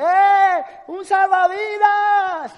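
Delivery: high, loud and drawn out, a hammed-up cry; loud, stretched alarm; mock shout for help. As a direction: call out across the water. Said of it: "hay dos chicos besándose y dicen".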